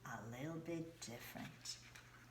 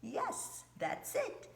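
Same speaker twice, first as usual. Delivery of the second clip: high excited praise; 8 semitones higher, 4 dB louder than usual. Real, not acted. Praising children's repetition.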